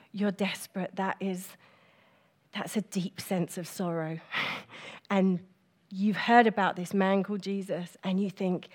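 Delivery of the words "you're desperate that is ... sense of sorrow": hushed sorrow. Real, not acted. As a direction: let the voice fall quiet with sorrow. Speaking of the woman's despair.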